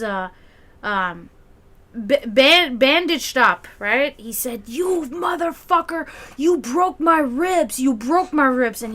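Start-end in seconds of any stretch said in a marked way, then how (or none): 4.7-8.8 slow, higher furious accusation; pissed off. Retold or imitated. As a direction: drag out the accusation slowly, seething. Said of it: "he was so pissed off".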